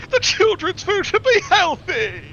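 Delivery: high, slow, wailing mock sob; Jamie Oliver crying. South Park chef wailing about children's food. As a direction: whimper it like a weepy chef. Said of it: "He's like".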